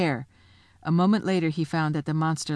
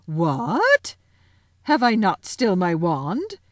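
louder, defiant witch queen; 3 semitones higher, 6 dB louder than usual. A narrator's reading. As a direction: scoff at defeat with power.

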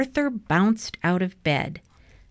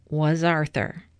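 ordinary delivery

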